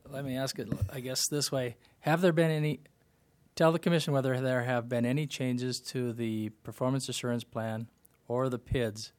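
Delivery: loud, deliberate repeat for listeners who missed it; speaking up. Real, not acted. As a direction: raise your voice to be heard on a bad line. Repeats after not being heard.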